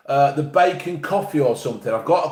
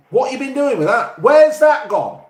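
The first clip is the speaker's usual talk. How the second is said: higher, louder indignant demand, slowed at the end; imagined demand; 9 semitones higher, 4 dB louder than usual. Retold or imitated. Boss demanding where the missing money went, indignant and higher. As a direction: demand answers like a fed-up boss. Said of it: "I would be saying".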